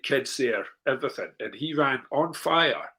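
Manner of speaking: ordinary delivery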